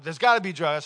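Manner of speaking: officer questioning the pastor about drugs, loud and blunt; loud questioning cop. Retold or imitated. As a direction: bark the question like a suspicious officer. Officer insists, disbelieving.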